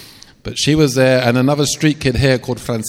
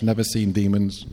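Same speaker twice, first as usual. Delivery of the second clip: ordinary talk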